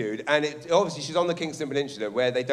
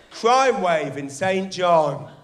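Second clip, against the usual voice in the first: dramatic headline; 4 semitones higher, 6 dB louder, much slower than usual. Retold or imitated. Reading the scary headline aloud.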